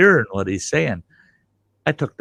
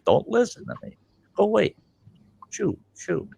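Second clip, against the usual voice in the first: dismissive shooing; 10 semitones higher, 5 dB quieter than usual. Acted. Waves off unwilling listeners.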